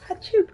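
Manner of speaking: a quick mock sneeze, high; fake sneeze. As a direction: act out a sneeze mid-sentence. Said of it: "I was like".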